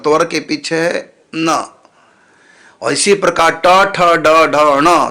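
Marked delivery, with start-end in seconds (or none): 3.5-5.1 टा ठा डा ढा णा, each syllable said separately; the retroflex row, letter by letter; letter recitation. Shown as a demonstration. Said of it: "टवर्ग के पीछे है णा".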